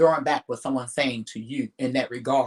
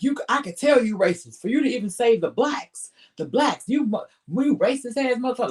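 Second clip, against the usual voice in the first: mock angry rant; 8 semitones higher than usual. Retold heated argument quote.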